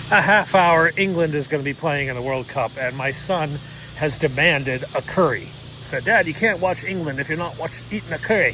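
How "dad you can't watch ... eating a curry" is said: hammed-up British accent, pitched higher; worst British accent. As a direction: imitate your kid with a bad British accent. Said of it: "my worst British accent possible".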